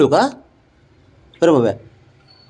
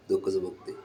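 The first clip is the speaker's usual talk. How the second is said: quiet aside; 7 semitones lower, 15 dB quieter than usual. Real, not acted. Muttered aside.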